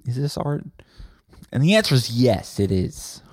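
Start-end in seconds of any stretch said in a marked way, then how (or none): 0.0-0.6 soft, higher, halting question; hesitant onlookers. Retold or imitated. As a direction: ask it timidly, unsure what this is. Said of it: "Everyone's like".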